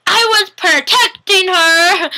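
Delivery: high, loud, drawn-out mock protest; hammed-up childish tantrum; frustrated little kid. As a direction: wail it like a kid denied a toy. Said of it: "he says that all frustrated".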